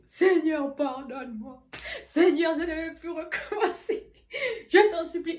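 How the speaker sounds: loud, high, laughing through a mock sob; hamming up a desperate prayer; mock tearful pleading. Praying to God in her room, hammed-up tearful begging. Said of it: "Je pleure, je marche, je dis".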